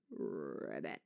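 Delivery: low, slow, gravelly ribbit voice; frog voice. Shown as a demonstration. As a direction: rasp it out like a frog. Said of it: "It's very gravelly, it's very low".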